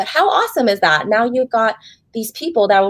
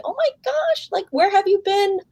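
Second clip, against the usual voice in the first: imitating grateful client; 12 semitones higher than usual. Voicing crying grateful clients.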